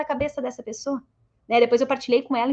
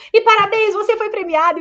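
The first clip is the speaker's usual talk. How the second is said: playful congratulations; 7 semitones higher, 7 dB louder than usual. Retold or imitated. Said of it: "E brinquei na hora lá".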